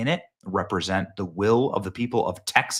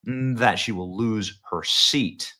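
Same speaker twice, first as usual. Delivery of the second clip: ordinary talk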